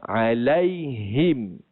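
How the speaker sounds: alaihim drawn out slowly with a clean I; slow recited alaihim; slow correct alaihim. Shown as a demonstration. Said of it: "jangan kita baca alaihim".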